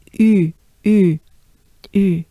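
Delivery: "U" said with tight rounded lips, small opening; "U" like "ee" with pursed lips; French U. Shown as a demonstration. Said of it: "Small opening, very small".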